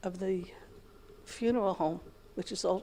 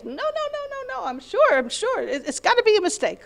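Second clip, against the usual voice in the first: much higher, frantic denial; husband denying his mother died, pitched high in disbelief; disbelieving protest; 11 semitones higher than usual. Retold or imitated. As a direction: refuse to believe it, voice shooting up. Husband refusing to believe death.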